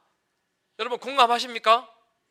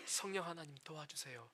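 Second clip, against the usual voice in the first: soft, low plea for help; quiet prayer; 6 semitones lower, 19 dB quieter than usual. Shown as a demonstration. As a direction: pray it quietly, like a plea under the breath. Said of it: "뭐라 해야 됩니까". (낮고 조용하게 간절히 드리는 기도)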